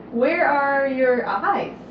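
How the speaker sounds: drawn-out slow question; slow sing-song. Shown as a demonstration. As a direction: stretch it out slowly for a baby.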